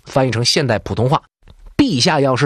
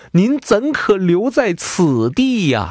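slow, higher, drawn-out weepy whine; hammed-up teary lament; mock tearful plea; 8 semitones higher, much slower than usual. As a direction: stretch it out like a courtier about to cry. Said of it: "说完就哭了".

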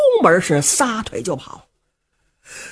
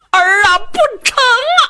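crying out much higher, voice breaking; anguished old father; 14 semitones higher, 3 dB louder than usual. Acted. Old father crying out to stop his son selling the land, anguished. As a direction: beg your son not to sell the land.

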